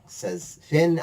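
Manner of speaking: normal talking voice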